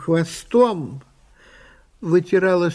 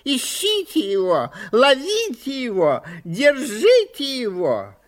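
screeching magpie; 10 semitones higher than usual. A narrator's reading. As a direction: shriek like a chattering magpie. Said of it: "громче всех кричала".

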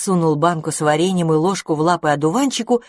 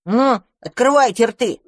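commanding; 6 semitones higher, 4 dB louder than usual. Acted. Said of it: "велел мышевраг".

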